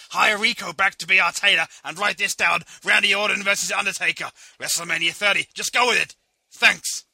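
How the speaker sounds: loud urgent phone orders; excited orders. Acted. Vince calling Johnny, loud excited orders.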